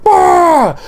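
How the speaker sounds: sudden loud, high-pitched yell; mock scare shout. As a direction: burst out as if to startle a wolf. Jumping at wolf, mock scare.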